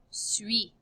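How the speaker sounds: whispered model of "Suis". Shown as a demonstration. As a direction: whisper "Suis" as swee, no final s. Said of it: "let's look again at the pronunciation".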